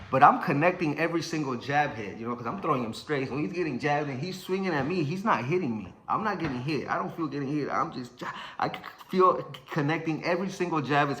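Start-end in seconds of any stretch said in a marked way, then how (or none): none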